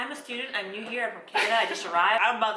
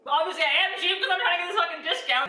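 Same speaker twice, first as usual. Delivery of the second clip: higher mock voice, owning it; defiant retort; 11 semitones higher, 4 dB louder than usual. To the Ghanaian hairdresser, a higher defiant retort. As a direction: play up being cheap on purpose. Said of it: "And I'm like".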